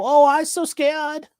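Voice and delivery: high, slow, whiny fake fright; mock the officer as a scaredy-cat; mock fear. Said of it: "And he go".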